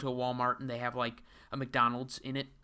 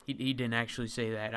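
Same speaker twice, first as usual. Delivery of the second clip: ordinary talk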